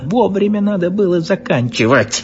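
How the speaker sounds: barking. A narrator's reading. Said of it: "рявкнул Львович".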